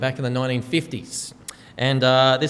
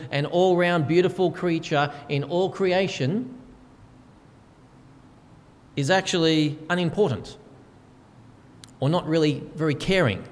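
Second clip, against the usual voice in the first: usual speaking voice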